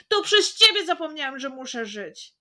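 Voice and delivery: loud, high and fast accusation; accusing cry. Acted. Boy blaming the phone, loud fast outcry. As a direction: hurl the blame at the phone. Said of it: "zawołał".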